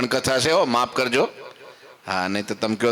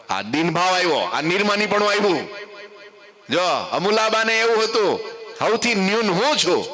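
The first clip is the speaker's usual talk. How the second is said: impassioned preaching; 8 semitones higher than usual. Acted. Preacher warning the gathering, high and impassioned. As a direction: drive home the misery of ego.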